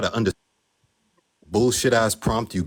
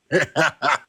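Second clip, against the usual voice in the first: short higher-pitched chuckle burst; brief staccato laugh; dismissive laugh; 5 semitones higher than usual. Real, not acted. Laughs at opponent's commands.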